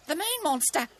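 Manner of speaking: high, hushed-style scared bird; mock whisper in a high frightened voice; frightened kookaburra confiding a secret; fearful whisper. A narrator's reading. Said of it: "Cookie whispered".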